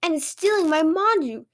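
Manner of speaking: high-pitched, stuttering fright; alarmed stammer. A narrator's reading. Shop owner sees floating lips stealing his buns, alarmed stammer. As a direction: stammer it high, scared of a ghost. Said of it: "he shouted".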